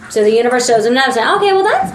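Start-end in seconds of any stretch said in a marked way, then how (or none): 1.4-1.9 higher-pitched, hedging put-on voice; sceptical Virgo. Doubting Virgo answering the universe's sign, high and hedging. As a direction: pitch it up, reluctantly half-convinced.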